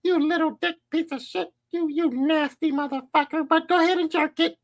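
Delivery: high, sneering degrading voice; imitating a JOI video woman; mocking dominatrix. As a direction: taunt him like a scornful mistress. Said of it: "women telling them".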